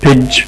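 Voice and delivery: pidge said with d and j together; first syllable alone. Shown as a demonstration. Teacher breaks the word into syllables, isolated sound. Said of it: "ال G اتنطق D و J مع بعض".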